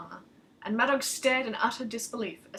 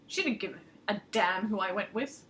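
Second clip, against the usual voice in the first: ordinary delivery, nothing marked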